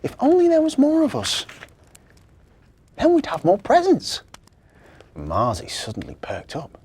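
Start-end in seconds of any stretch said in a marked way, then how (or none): 0.0-4.2 put-on high, louder young girl; wistful little girl, pitched up. Victoria wishes for more presents, high girl voice.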